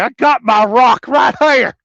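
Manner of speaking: high, very loud hammed-up holler; mock shouted comeback. Trucker retelling his answer about his rock, mock shouting. Acted yelled comeback to a viewer.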